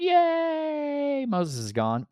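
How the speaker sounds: high squeaky mock cheer; mocking the people. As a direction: cheer like a silly crowd. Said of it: "They're like".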